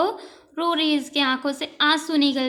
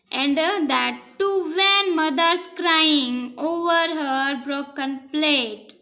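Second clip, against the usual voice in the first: slow read-aloud; much slower than usual. Shown as a demonstration. Princess's line read slowly.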